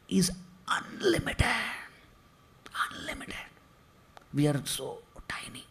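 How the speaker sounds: hushed, breathless whisper; awed whisper. Real, not acted. Preacher whispers in awe of the unlimited Lord. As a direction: whisper it as if overwhelmed by the vastness.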